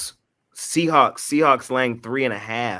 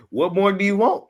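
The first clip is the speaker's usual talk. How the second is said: high, loud question through laughter; laughing; 11 semitones higher, 5 dB louder than usual. Real, not acted. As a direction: crack up while asking, pitch way up. Laughing over quarterback's blunt quote.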